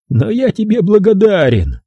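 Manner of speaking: high-pitched mock gratitude; high, gloating thanks; taunting captor. A narrator's reading. Captor mockingly thanks narrator.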